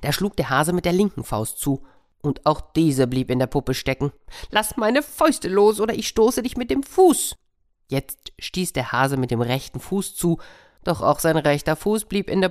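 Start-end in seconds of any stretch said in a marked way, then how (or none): 4.5-7.4 loud high threat from the stuck hare; higher and louder hare's threat. Acted. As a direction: yell in rising panic.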